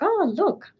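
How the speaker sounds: high-pitched acted amazement; pleasant surprise. Recalling arrival in the States, pleasantly surprised. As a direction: relive spotting something new, pitch leaping up. Delighted reaction on seeing many nationalities.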